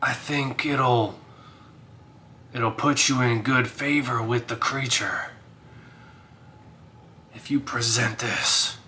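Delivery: whispered spirit. Acted. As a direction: breathe the advice like a ghost. Wisp spirit advises.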